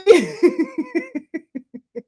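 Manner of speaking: high burst of real laughter; laughing at herself. Real, not acted. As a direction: crack up at your own bit. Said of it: "[name] laughs at her goddamn selves".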